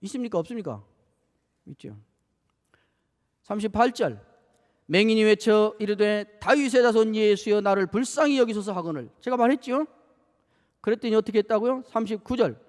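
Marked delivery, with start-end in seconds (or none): 4.9-9.1 scripture reading. Acted. Preacher reads the blind man's cry aloud. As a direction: proclaim the verse to the congregation.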